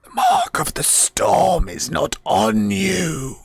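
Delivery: slow, rasping spirit voice; rasping undead voice. Acted. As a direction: rasp it out like an undead guardian. Door spirit answers the party.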